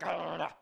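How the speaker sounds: loud walrus growl; mock savage roar. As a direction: roar like a walrus ripping prey apart. Imitating a savage walrus attacking.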